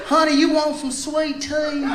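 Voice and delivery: slow, drawn-out Southern waitress voice. Retold or imitated. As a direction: sweet-talk like a Southern waitress, slowly. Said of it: "She said".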